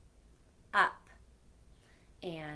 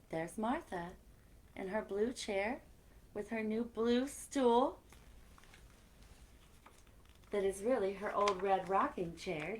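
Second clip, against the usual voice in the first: lively storybook read-aloud; reading to children. A narrator's reading. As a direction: tell it like story time for kids. Reads picture book aloud.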